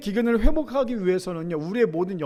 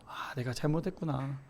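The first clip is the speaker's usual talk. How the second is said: quiet realization; 6 semitones lower, 7 dB quieter than usual. Retold or imitated. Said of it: "뒤늦게나마 깨달았겠죠".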